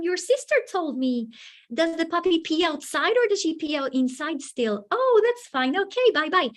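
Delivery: bright, high greeting voice to students; chirpy hellos to each pupil by name; upbeat classroom greeting; cheerful teacher greeting. Shown as a demonstration.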